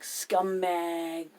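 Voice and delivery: loud retold insult, drawn out; mock yell of abuse; imitated yelling. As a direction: call it out like an angry yell. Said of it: "they're yelling".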